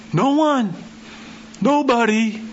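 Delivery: high, emphatic declaration; high, emphatic insistence; dramatic no one. Acted. Preacher voicing everyone's denial.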